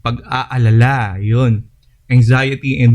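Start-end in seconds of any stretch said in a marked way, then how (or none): 0.0-1.2 "Pag-aalala" said slowly, each syllable clear with the long aa; slow, deliberate "Pag-aalala" after tripping on the word; careful correction. Shown as a demonstration. Lecturer fixes a slip for listeners, slowly enunciating "Pag-aalala". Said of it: "Nabubulol na ako".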